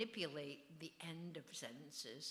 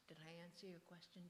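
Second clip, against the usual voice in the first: whispered aside; 13 dB quieter, much faster than usual. Real, not acted. Aside to daughter mid-talk.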